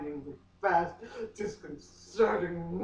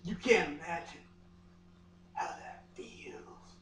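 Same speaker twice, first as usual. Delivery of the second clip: whisper; 9 dB quieter than usual. Acted. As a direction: whisper it with eyes closed. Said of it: "he said".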